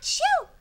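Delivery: a big high, loud fake sneeze; hammed-up sneeze. Reading the fic, acting the princess sneezing with a loud fake sneeze. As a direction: blast out a cartoon sneeze. Character sneezes in read fanfic.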